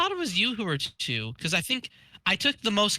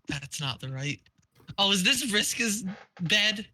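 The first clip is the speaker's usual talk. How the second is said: usual speaking voice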